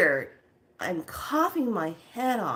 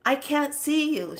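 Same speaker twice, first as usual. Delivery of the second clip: raised, higher searching voice; acted calling out; 9 semitones higher, 4 dB louder than usual. Dreamer calling to unseen young one, raised voice. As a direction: call back through the mist, pitched up. Calling back through smoke.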